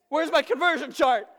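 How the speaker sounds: high, loud exasperated demand; comic frantic yell; panicked outburst. Retold or imitated. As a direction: shout it like a frazzled parent. Frazzled parent reenacts medicine panic.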